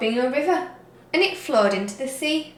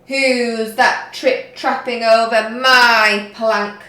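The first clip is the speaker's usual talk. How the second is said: loud, slow mocking taunt; jeering; 8 dB louder, slower than usual. A narrator's reading. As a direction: sneer it down the plank. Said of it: "jeered Hank Chief".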